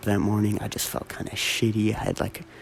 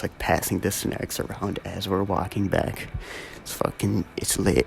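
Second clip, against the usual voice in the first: ordinary talk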